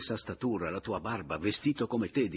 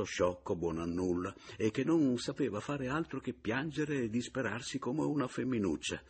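ordinary delivery

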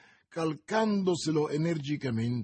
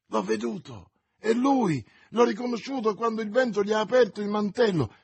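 agitated; 8 semitones higher, 3 dB louder than usual. Acted. Recounting sighting of his enemy, agitated.

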